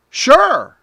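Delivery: high, loud quoted sure; lightly voiced salesman saying sure; salesman's reply. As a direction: mimic the salesman caving.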